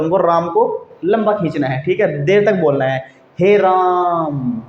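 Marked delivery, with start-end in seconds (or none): 3.4-4.6 हे राम with राम held very long and slow; teacher demo of a prolonged राम; drawn-out he Ram. Shown as a demonstration. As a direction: stretch राम out for a long time. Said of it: "ऐसे बोलना है".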